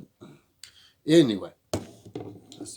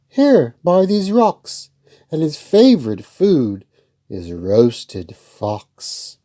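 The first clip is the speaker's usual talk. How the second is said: higher, louder little mouse, politely refusing; a brave little mouse with a higher voice; plucky mouse; 4 semitones higher, 10 dB louder, slower than usual. Acted.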